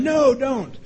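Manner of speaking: high-pitched mock protest; hammed-up scream without much volume. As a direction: cry out in pretend alarm, pitched high. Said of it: "I was screaming the whole time".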